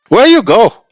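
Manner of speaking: put-on high, loud cab driver in an Indian accent. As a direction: greet the passenger brightly in an Indian accent. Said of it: "do an Indian accent".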